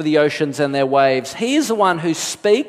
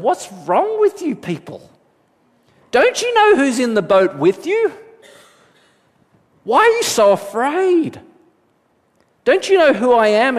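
raised, pressing rebuke in a lightly voiced Jesus; rebuking Jesus; 6 semitones higher than usual. Jesus scolds the frightened disciples in the boat, raised and pressing. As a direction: ask it pointedly, as if scolding friends.

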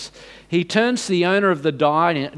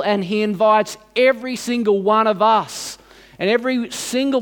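fervent preaching; 5 semitones higher than usual. Acted. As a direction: lift the congregation towards the invitation. Sermon climax, rising fervour.

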